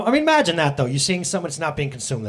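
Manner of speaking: ordinary talk, nothing marked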